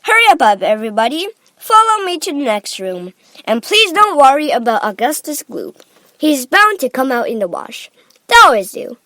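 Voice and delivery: brisk, loud rallying voice; Mister Wonka. A narrator's reading. Factory owner hurrying visitors to the next room, calling. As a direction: hurry the group along loudly. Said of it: "cried Mister Wonka".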